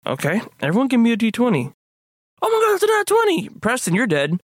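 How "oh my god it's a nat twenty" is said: high, fast excited shout. Real, not acted. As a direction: cheer the lucky roll. Excited roll.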